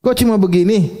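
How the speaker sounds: high-pitched mock complaint of a dissatisfied wife; nagging "is this all?" wife; complaining wife. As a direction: raise the pitch in reproachful disbelief.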